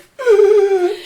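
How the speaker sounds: very high, loud wheezing gasp; hammed-up winded squeal; breathless gasping. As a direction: gasp as if you can't get air. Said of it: "can't even fucking breathe".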